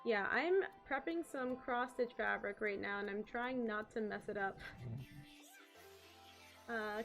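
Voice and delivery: ordinary talk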